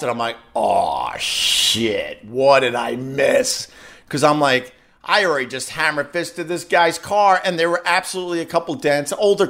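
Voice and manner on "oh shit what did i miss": retold dismay. Dismayed inner reaction, retold.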